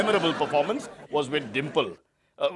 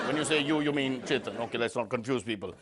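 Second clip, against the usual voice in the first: soft, lower aside; quiet aside; 7 semitones lower, 8 dB quieter than usual. Acted. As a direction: drop the voice for a wry aside. Host aside, dropping voice.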